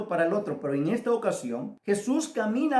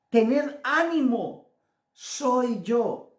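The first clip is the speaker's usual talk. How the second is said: raised, slow proclamation as Jesus; 5 semitones higher, 3 dB louder, slower than usual. Retold or imitated. Jesus answering his frightened disciples, proclaimed reassurance. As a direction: call out encouragement slowly and higher. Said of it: "A lo que Jesús les responde".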